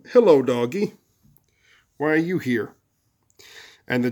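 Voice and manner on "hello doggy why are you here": lightly acted curiosity; a hint of a curious kid greeting a dog; gently inquisitive; mildly interested boy. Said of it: "come down to interest".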